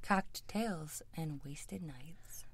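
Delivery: breathy whispered show title, slow; whispered tagline. Acted. Guest whispers the show name at the sign-off, hushed whisper. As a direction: breathe the podcast name like a secret.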